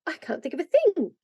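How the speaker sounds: high, flustered protest; in a twitter. A narrator's reading. Polly can hardly stand still, refusing in a twitter. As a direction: pitch it up, at a loss. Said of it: "in such a twitter".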